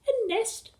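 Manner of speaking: very high, puzzled squeak; tiny, high child's question; children's voice. A narrator's reading. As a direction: pipe it up in wonder. Children answering together.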